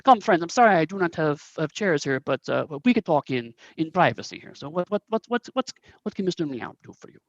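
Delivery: apologetic host with a thick, bad Korat accent; stammering, put-on Korat accent; Mister Meow. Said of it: "very thick, um, Korat accent".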